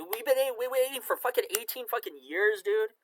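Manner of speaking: high, loud frustrated rant; exasperated outburst. Real, not acted. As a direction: blow up at the long wait, voice cracking high. Venting about an eighteen-year wait.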